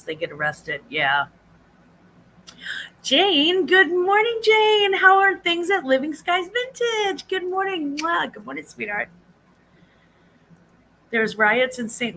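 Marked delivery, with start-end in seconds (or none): 3.0-7.3 high, loud sing-song hello to Jane; much higher, loud happy calling out; excited greeting. Real, not acted. Greeting a viewer by name. 7.3-8.0 high sing-song greeting. Real, not acted. Greeting a viewer. 8.0-8.3 high smacking kiss; high mwah kiss; air kiss. Real, not acted. Blowing a kiss to viewer. 8.3-9.1 tender greeting. Real, not acted. Greeting a viewer softly.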